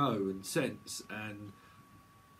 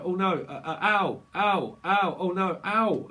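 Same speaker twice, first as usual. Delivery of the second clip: mock pain; 5 semitones higher, 7 dB louder than usual. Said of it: "It's like".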